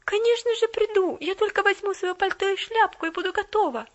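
high, loud eager promise; eager little girl. Acted. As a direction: answer with quick delight. Pollyanna eager reply.

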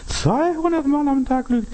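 very high squealed disbelief; astonished repeat. Shown as a demonstration. Preacher repeats the lying statistic to congregation, high-pitched astonishment. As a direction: shoot up into a high, held pitch of amazement.

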